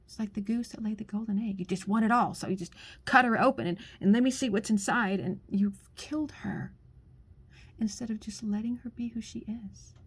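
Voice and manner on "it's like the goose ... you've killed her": normal talking voice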